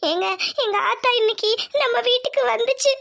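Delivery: tearful, sobbing confession; crying. Acted. Wife telling husband her mother came today, crying. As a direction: get the words out between sobs. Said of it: "ஏன் அழுகுற?".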